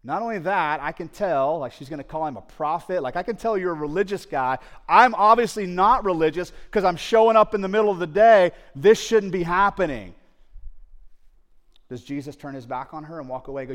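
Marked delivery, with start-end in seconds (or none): 3.2-8.5 raised, higher voice, pushing back; louder and higher, protesting her own unworthiness; agitated protest. Acted. Samaritan woman objecting to Jesus, raised and higher. 8.7-10.1 "This shouldn't be happening" said louder and higher, pushing back; a raised, higher, insistent "This shouldn't be happening"; agitated protest. Acted. Samaritan woman objecting to Jesus, raised and higher.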